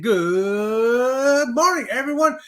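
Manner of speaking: very long, rising "good"; slow wind-up hello; drawn-out greeting. Acted. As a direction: stretch the first word way out, then finish. Acts out a viewer's greeting.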